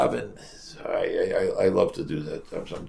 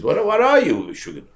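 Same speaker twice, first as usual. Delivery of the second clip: higher and louder, challenging; mock cop shout; 8 semitones higher, 5 dB louder than usual. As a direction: bark the question like a suspicious trooper. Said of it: "Is, you know".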